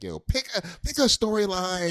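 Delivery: high-pitched heckling from an exasperated viewer; mock critic yelling at the screen; Fear. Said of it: "Fear is watching this".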